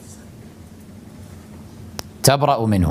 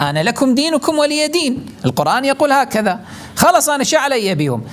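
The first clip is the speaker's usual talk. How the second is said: a much higher, sing-song mock of someone quoting the verse; mocking excuse; 11 semitones higher, faster than usual. Preacher mocking people who misuse the verse, high and smug. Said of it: "يقول لك".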